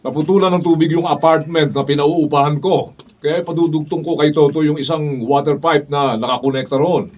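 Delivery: loud, domineering high shout; bullying shout. Acted. Bully brother-in-law yelling at Oreng over the pipe, loud and high. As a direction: bark orders at the sister-in-law.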